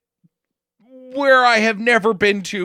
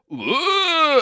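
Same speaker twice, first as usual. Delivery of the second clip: mock dying grunt; 10 semitones higher than usual. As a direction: ham up a short death grunt. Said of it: "for Stallone to go".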